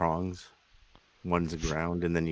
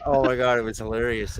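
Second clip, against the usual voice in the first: ordinary talk, nothing marked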